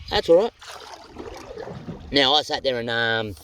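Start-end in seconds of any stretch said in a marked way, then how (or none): 0.1-0.5 light, high, easy; same high lift, rising at the end; high repeated reassurance. Real, not acted. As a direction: brush it off again, up high.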